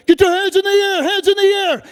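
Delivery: armed officer screaming orders; police shout. Acted. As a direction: yell it like a cop in a raid.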